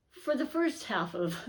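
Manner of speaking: tripping over her words with a laugh; stumbling, laughing through the date; stuttering. Real, not acted. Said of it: "I'm already stuttering".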